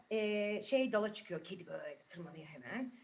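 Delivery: usual speaking voice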